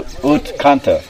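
ordinary talk, nothing marked

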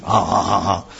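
mock monkey hooting; monkey chatter. Krishna teasing Radha about the monkey, light monkey imitation.

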